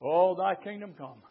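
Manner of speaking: ordinary delivery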